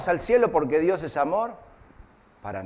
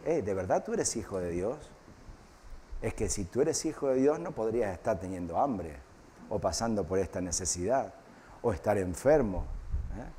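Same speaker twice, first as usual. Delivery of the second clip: soft, insinuating devil, quieter; whispering devil; 8 semitones lower, 9 dB quieter than usual. Retold or imitated. Preacher imitating the devil whispering doubts to believers, soft and sly. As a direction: murmur the doubts in like a tempter. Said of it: "nos susurra y te dice".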